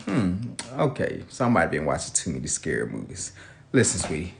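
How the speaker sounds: calm her down gently; soothing dad. Retold or imitated. Father reassures frightened daughter at bedtime, low and soft.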